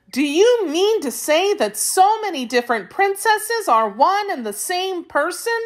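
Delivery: put-on very high-pitched girlish disbelief; astonished. A narrator's reading. Dorothy querying the Wheeler's story, astonished. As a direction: question it in high amazement. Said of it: "said Dorothy in astonishment".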